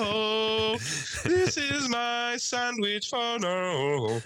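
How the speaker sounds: high, slow put-on preacher voice; hammed-up falsetto blessing; mock falsetto prayer. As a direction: drag it out in a silly high voice.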